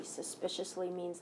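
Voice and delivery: ordinary delivery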